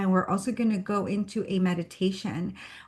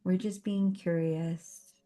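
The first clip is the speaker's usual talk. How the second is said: guided meditation. Real, not acted. Host grounding a meetup group before the session, guided meditation. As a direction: guide them gently through each breath, unhurried.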